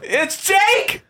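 high, loud delighted call; excited shout. Real, not acted. Friend calling out the spy's name, high and loud. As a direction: yell the name out in triumph. Guessing the spy.

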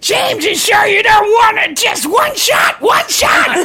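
squeaky high wheedling plea; high pleading voice. Acted. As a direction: beg him in a shrill high voice. Pushing a drink on James.

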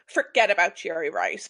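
ordinary delivery

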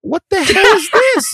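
loud high shocked outcry; shocked shout. Real, not acted. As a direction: yell it in disbelief. Belt revealed, shocked outcry.

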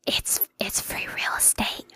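breathy slow whisper in the ear. Pretend. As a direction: lean in and whisper it slowly. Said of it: "let me whisper in your ear".

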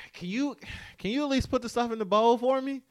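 imitated high-pitched wheedle; reluctant pleading. Imitating husband asking wife to fill bowl, reluctant pleading.

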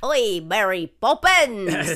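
hammed-up Cockney call; bad Cockney accent. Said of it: "it's pretty bad".

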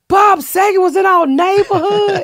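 very high, loud amazed cry; imitating a whole neighborhood losing it; excited neighbors. Comedian mimics neighbors seeing the photos, excited and amazed.

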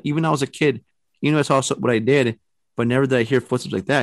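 normal talking voice

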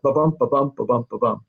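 "ba-bump" as a steady pulse, each BUMP stressed; iambic beat. Shown as a demonstration. Writer telling listeners what they'll hear, iambic stress on "bump". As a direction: beat "ba-bump" evenly, weak then strong. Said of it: "listen for it".

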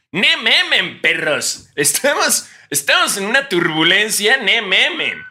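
high, loud hyped vlogger voice; Luisito Comunica impression. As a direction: hype it up like a YouTuber in turbulence. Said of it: "serías el Luisito Comunica del peligro". (imitando a Luisito Comunica, agudo y fuerte)

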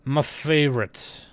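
drawn-out FAVORITE in a put-on voice; playful put-on voice. Vlogger savoring a favorite series, playful put-on voice. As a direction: stretch out FAVORITE slowly, playfully affected.

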